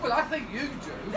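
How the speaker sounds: ordinary talk, nothing marked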